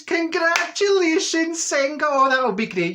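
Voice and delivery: high, loud, drawn-out gush of delight; acted squeal of joy for a friend; excited congratulations. Congratulating Senga on the postcode lottery, hugely excited. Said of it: "I was getting all excited for her".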